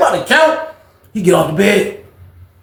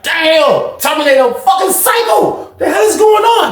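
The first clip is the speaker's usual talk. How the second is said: shocked outburst; 13 semitones higher, 3 dB louder, slower than usual. Retold or imitated. Seeing the laser on the track.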